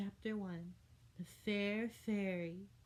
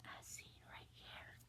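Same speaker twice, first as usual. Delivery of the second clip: a hushed, breathy aside to the listener; whispered aside; 15 dB quieter than usual. A narrator's reading. Narrator whispers to the child, pointing at the picture.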